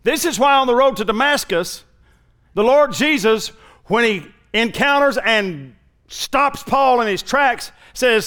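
ordinary delivery

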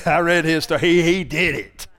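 laughing while saying it. Real, not acted. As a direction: chuckle through the words. Repeats it laughing.

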